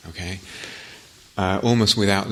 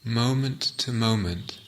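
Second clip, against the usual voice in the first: guided meditation. Acted. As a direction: guide listeners gently inward, phrase by phrase, leaving silences.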